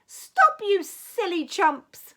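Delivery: high, loud shout, slowing on chumps; shouting stop. Acted. Princess stopping the knight and dragon's fight, loud shout. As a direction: yell to break up the fight.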